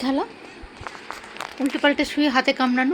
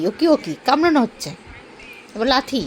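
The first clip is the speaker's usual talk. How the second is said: ordinary talk, nothing marked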